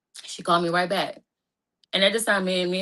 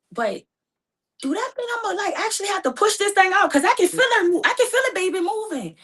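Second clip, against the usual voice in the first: panicked; 13 semitones higher, 4 dB louder than usual. Real, not acted. Panicking about pushing the baby out.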